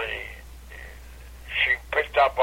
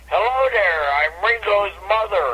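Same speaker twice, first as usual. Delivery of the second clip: stage greeting; 8 semitones higher than usual. Retold or imitated. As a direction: greet the screaming kids brightly. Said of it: "I said".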